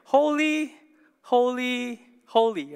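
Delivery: each HOLY held out, high and loud, stepping down; emphatic repetition. Shown as a demonstration. Preacher quoting the hymn to show Hebrew emphasis, emphatic. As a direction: sound out "Holy, holy, holy" in ringing separate beats. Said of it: "that's just how they emphasized".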